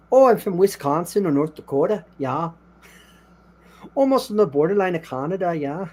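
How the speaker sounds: a hint of a Wisconsin accent with a rising yeah; Upper Midwest accent. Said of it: "I don't sound like someone from".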